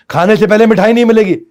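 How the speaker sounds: loud high scolding; shouted no. Shown as a demonstration. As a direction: snap it like an angry parent. Harsh vs positive phrasing.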